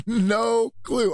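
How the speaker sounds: usual speaking voice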